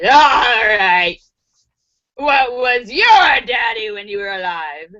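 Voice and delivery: drawn-out devil voice, swelling on "your"; hammed-up demonic questioning; Satan. Satan questions the third dead man, menacingly. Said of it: "Satan goes to the third guy".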